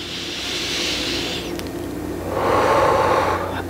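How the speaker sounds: long, soft breath out; relieved breath. Retold or imitated. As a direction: breathe out as the pain lifts. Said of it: "And I'm like".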